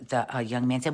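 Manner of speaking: ordinary delivery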